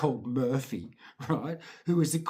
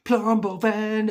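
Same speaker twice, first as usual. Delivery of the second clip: slow, high, loud drawn-out call; excited call; 7 semitones higher, 6 dB louder, slower than usual. Retold or imitated. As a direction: stretch out PLUMBO VAN as an excited cry. Kids hail the van.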